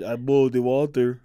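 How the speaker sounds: badly faked Russian accent; attempted Russian accent. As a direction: have another clumsy stab at Russian. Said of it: "Do this in a Russian accent".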